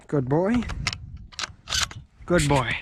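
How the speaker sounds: lightly cooing pet praise. Pretend. Praising rifle like dog.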